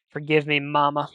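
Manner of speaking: loud quote with a hint of cartoon hunk; loud, lightly acted plea to mama; Johnny Bravo-like. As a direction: call it out loudly, lightly cartoonish. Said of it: "the way I said it reminded me of Johnny Bravo".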